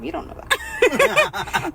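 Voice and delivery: very high, slow, put-on squeaky voice; silly high mock retort. Mock reply to own narration.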